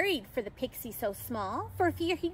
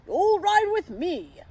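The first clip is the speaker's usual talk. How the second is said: loud, slow, booming invitation; joyful Santa shout; 4 semitones higher, 7 dB louder, slower than usual. A narrator's reading. Santa inviting the pixie to ride, joyful shout. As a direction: boom it out with joy. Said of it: "Santa shouted with joy".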